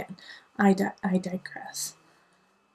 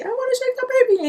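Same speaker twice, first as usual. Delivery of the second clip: very high, fast playful voice; high fast mock voice to her son; playful sing-song; 3 dB louder, faster than usual. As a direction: tease like a silly mom. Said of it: "I'd say".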